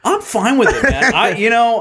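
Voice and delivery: laughing. Real, not acted. Laughing while answering.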